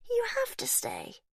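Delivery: put-on eerie child whisper. A narrator's reading. Faith whispering to her daddy, eerie. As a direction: breathe it like a ghost. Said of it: "she said".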